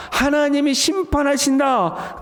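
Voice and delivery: high, drawn-out proclamation. Acted. Preacher proclaiming God's judgment to all creation, crying out. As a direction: announce it slowly to the whole world.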